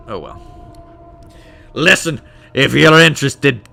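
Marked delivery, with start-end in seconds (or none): 1.7-3.7 louder dwarf with a rough try at Scottish; attempted Scottish accent. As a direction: dismiss them in an imperfect Scottish brogue. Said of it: "wish I could do a Scottish accent".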